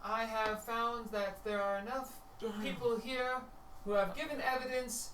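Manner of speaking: high, formal pronouncement; Justicer's verdict. Acted. Justicer pronouncing the prisoner guilty, high and formal. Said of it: "woman says".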